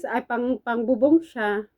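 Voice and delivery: ordinary talk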